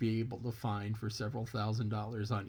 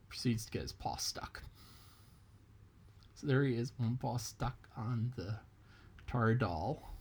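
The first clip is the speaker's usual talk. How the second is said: plain reading voice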